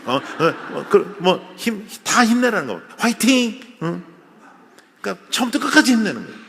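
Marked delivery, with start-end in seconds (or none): 3.0-3.6 mock cheer.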